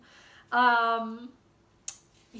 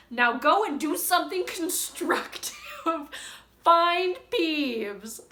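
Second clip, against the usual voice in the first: raised, commanding scolding voice; McGonagall; 5 semitones higher, 4 dB louder, slower than usual. Acted. Professor sending the caretaker off, raised scolding voice. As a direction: order him off, pitched up. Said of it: "shouted McGonagall".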